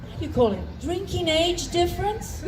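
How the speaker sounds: ordinary talk, nothing marked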